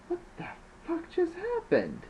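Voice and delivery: very high, scared, excited cry. Acted. Trash jumping about after the attack, scared and excited. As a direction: squeal it out, shaken. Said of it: "both scared and excited".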